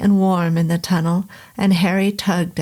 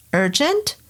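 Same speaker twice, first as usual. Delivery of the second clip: sharp high questioning rise; querying; 8 semitones higher than usual. Acted. Professor doubting the visitor's urgent plea, high incredulous query. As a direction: toss the word back in disbelief. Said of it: "queried Professor Thorin".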